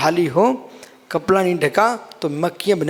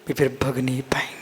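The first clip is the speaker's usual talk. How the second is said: soft whisper; 5 semitones lower, 5 dB quieter than usual. Acted. Preacher to listeners, describing the stilled mind, whispered.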